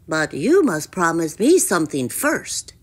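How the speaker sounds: bargaining froggy voice; croaky, insistent creature; croaking frog. A narrator's reading. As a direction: set a condition in a frog's croak. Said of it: "he croaked".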